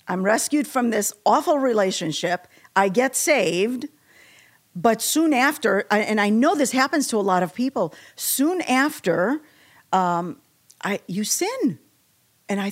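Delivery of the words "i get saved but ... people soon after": ordinary talk, nothing marked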